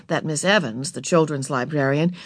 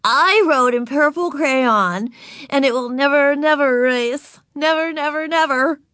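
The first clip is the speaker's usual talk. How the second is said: put-on high, loud little-girl bragging; unashamed; 9 semitones higher, 5 dB louder than usual. A narrator's reading. Ramona bragging to Miss Evans about crayon, high and gleeful. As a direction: boast with relish, hammering each never. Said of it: "not the least ashamed".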